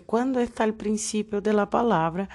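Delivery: usual speaking voice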